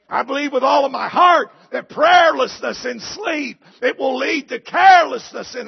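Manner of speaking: loud, high preacher's cry; shouted conviction; preaching shout. Acted. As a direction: drive the point home at full volume. Preaching climax.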